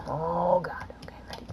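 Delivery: hushed, breathy whisper before the run. Real, not acted. Gamer whispering to himself before the run. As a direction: whisper it, holding your breath. Tense moment before level start.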